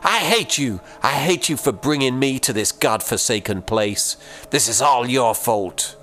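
mother screaming at her absent husband, furious; anguished scream. Acted. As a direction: hurl it at the empty room. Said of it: "she screamed at the empty room".

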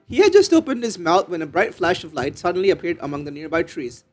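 ordinary talk

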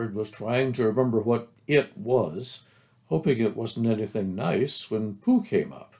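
plain reading voice

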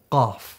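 Qaf said from the back of the mouth; Qaf demonstrated. Shown as a demonstration. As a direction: model the letter Qaf, deep in the throat. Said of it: "going to go through the letter".

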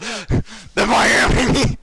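Batman voice attempt. Player attempting Batman again, stretched and failing. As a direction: drag it out as Batman and crack. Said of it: "I can't do it".

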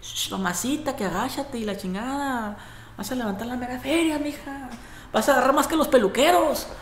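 mock teasing catcall. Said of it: "le estábamos echando carrilla".

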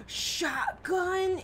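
gasp, then a high excited shout; thrilled, surprised outburst. Real, not acted. Finds shotgun, surprised.

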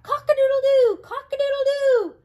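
very high, very loud crowing; full-throated hammed-up crow; rooster crow. Rooster wakes and crows.

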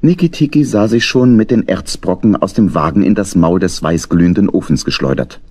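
ordinary delivery, nothing marked

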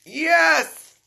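high, loud cheer of delight; squealed yes; excited yell. Real, not acted. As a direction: shout it out thrilled. Unboxing a wanted collectible, cheering.

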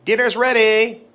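high, loud call through the house. Shown as a demonstration. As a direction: call the family to dinner. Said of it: "flashcard drill demo".

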